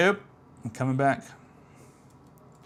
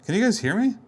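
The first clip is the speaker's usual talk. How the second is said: raised, higher voice, checking they can hear; calling out to viewers; 12 semitones higher, 7 dB louder, faster than usual. Real, not acted. Asks viewers if the mic worked, loud and high. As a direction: call out, half worried the mic was dead.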